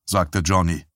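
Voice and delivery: plain reading voice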